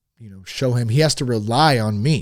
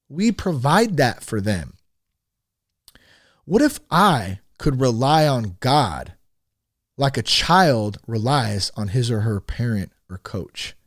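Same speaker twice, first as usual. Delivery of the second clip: usual speaking voice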